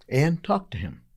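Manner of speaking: a gentle hint of God's voice, set apart from the telling; lightly voiced divine prompting, calm and close; voice of the Lord. God speaks to tired Ann.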